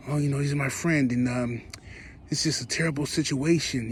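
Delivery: a put-on mournful voice for a friend; mock sad and somber. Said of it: "sounds so sad and somber".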